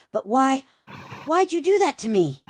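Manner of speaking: high, strained, teeth clenched; through gritted teeth. Acted. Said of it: "Tries to speak through gritted teeth".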